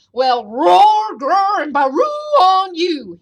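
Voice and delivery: very loud, drawn-out mocking roars; booming storybook giant taunt; yelling giant. A narrator's reading. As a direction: yell it as a towering giant. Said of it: "he yelled".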